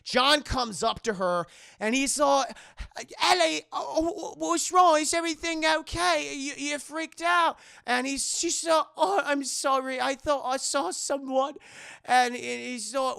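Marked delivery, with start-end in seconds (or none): 3.2-7.6 high, loud, put-on concerned voice; acted worried boyfriend. John finds her fallen in rain, high mock concern. Acts John checking on Ellie. 9.0-11.5 higher put-on girl's voice; acted apologetic girl. Ellie explains herself to John, high mock apology.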